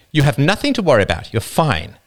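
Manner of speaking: a hint of the wife, reassuring. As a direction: hurry to reassure him.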